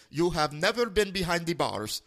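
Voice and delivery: "behind the bars" in a mock Indian accent; imitated Indian accent. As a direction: quote the scammer's phrasing accented. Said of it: "duplicate his voice and accent".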